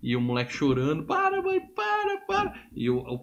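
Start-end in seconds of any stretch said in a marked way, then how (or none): 1.1-2.5 put-on much higher, panicked boy begging; crying child pleading. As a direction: beg like a terrified kid. Filho chorando pede à mãe. (filho implorando, voz bem aguda e assustada)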